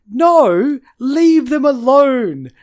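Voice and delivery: slow, loud, fed-up plea; exasperated. Real, not acted. Exasperated outburst.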